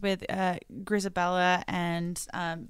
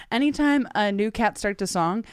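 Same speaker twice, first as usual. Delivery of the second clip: ordinary talk, nothing marked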